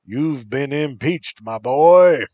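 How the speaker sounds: booming high bellow, swelling loud at the end; big gleeful bellow at his victim. A narrator's reading. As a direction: bellow it like a gloating strongman. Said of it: "he bellowed".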